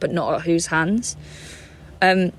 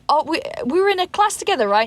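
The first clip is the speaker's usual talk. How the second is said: quick, much higher, over-eager question; fake schoolmate; 10 semitones higher, faster than usual. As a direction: rush it like a friend improvising cover. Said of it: "And I was like".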